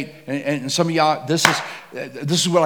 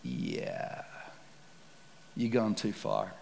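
sinister low voice; 6 semitones lower, 15 dB quieter, much slower than usual. Retold or imitated. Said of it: "the enemy starts saying to you".

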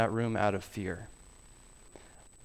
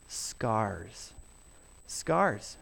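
hushed; 4 semitones lower, 8 dB quieter than usual. Acted. Solemn reveal of the wounds.

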